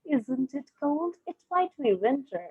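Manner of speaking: slow, lightly voiced sugary lady; a hint of a sweet, affected drawl. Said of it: "in her sweet, affected drawl".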